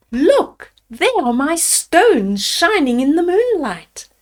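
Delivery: put-on high, eager cry of a boy finding the way home; excited discovery. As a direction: call out the find with sudden joy. Hansel spots stones at night.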